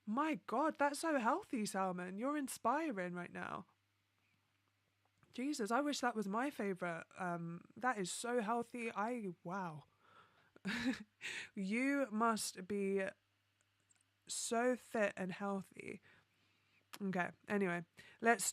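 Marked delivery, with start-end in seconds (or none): none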